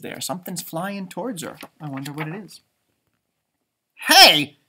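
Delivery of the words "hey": loud sudden Hey; loud call. Acted. Stranger calling out loudly to sleeping Stellaluna. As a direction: call out loudly to get attention. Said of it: "said a loud voice".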